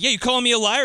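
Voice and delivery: mock angry challenge. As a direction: snap it back at him. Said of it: "Kilmer goes like".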